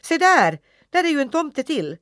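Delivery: high, loud startled cry at the mirror; boy yelling at his reflection; shouted out loud. A narrator's reading. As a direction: call out loud in shock. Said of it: "ropade högt".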